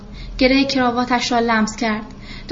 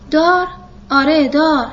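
shocked disbelief; 4 semitones higher, 4 dB louder than usual. Acted. Carpenter shocked by the request.

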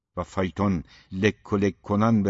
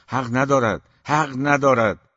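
higher, louder protest, slowing on the repeat; indignant; 5 semitones higher, 6 dB louder than usual. A narrator's reading. Mother objecting to Orhan's insults, indignant. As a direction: insist on it, harder the second time.

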